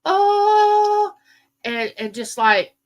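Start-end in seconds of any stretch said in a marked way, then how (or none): 0.1-1.1 very high, loud mock gasp of shock; a squealed "oh" of pretend horror; hammed-up shocked "oh". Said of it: "And I would go".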